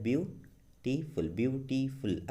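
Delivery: Beautiful slowly split into three beats, ब्यू-टी-फुल; syllable by syllable. Shown as a demonstration. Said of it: "ये तीन बार में बोला है". (Beautiful को तीन हिस्सों में तोड़कर धीरे बोलें)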